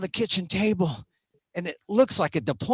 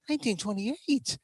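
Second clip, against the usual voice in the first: softer, raised pitch; subdued repeat; 4 semitones higher, 6 dB quieter than usual. Acted. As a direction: pull back to a small, high voice. Same year repeated after the outburst.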